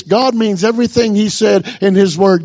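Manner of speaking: ordinary talk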